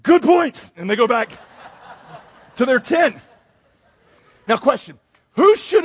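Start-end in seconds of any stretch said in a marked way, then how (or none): 0.0-0.7 high, loud sheepish admission; hammed-up defeated reply; deflated mock concession. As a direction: give in like a kid caught out. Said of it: "they're like".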